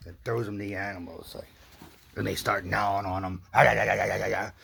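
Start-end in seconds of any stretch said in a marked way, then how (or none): none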